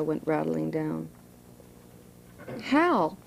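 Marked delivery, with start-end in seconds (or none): 2.6-3.2 a high pleading call of his name; wife pleading with Hal for help. A narrator's reading. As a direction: beg him to come help. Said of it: "Delilah pleaded".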